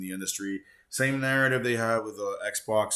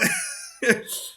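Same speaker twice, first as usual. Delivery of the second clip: high-pitched squealing laugh; 3 dB louder than usual. Real, not acted. Laughing at friend's message.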